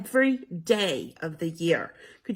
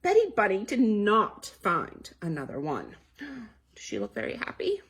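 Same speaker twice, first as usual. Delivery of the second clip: usual speaking voice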